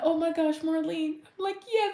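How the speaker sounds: high, fast gushing fan voice; imitated excited fan. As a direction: gush like a starstruck fan meeting her. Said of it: "they'll be like".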